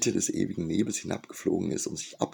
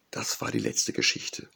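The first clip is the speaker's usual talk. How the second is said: hoarse; 7 semitones lower than usual. A narrator's reading. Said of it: "sagte Ria heiser".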